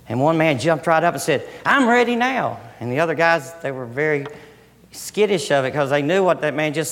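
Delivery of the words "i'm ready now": eager, high-pitched declaration; keen volunteer soldier piping up; joyous readiness. Acted. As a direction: jump up ready for battle, pitched high. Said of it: "how can you be so joyous".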